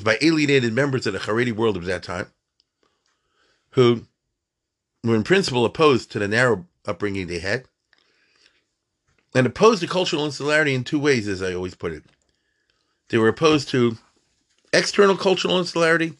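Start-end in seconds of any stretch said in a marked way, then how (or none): none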